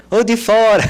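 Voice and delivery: high, loud reply from inside; answering call. Shown as a demonstration. Someone inside answering the visitor, loud. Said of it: "alguém lá de dentro responde".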